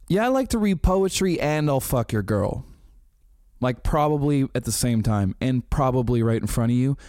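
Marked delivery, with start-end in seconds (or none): 0.1-2.6 higher, smug, smirking voice. Retold or imitated. Nick's smirking face voiced as a cocky heartthrob. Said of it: "As if to say".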